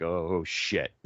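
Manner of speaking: low, quiet dread; oh shit. Retold or imitated. Valentine sitting there dreading it, low muttered oh shit. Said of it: "Valentine's sitting there like".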